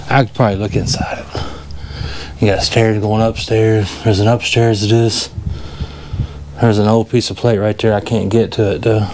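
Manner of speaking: ordinary delivery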